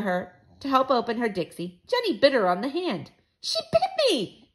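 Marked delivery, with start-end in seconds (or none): 3.4-4.5 high, startled cry of pain; shocked old lady crying out. A narrator's reading. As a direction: yelp it like a bitten grandma. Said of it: "Grandma cried".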